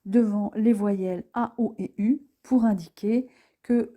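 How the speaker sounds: ordinary talk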